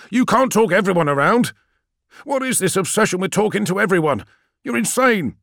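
high, loud outburst; hysterical. A narrator's reading. Friedish rails at Call's obsession with talking, hysterical. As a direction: rant hysterically at full pitch. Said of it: "You're being hysterical".

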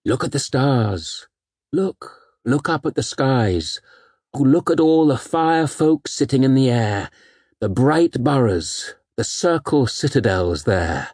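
slow, awed poetry reading; old sci-fi announcer. Acted. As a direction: read it slowly in wonder. Recites Hopkins poem.

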